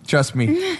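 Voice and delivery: high, laughing "trust me"; host laughing off a joke about his bad aim. Real, not acted. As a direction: laugh it off with confidence. Laughing rebuttal.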